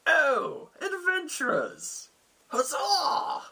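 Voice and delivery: very high, drawn-out hammed-up cheer; delighted mad lord. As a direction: greet visitors as an overjoyed, batty lord. Mad lord greets the adventurers.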